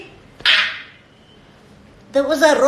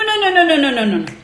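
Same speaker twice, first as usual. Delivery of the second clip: usual speaking voice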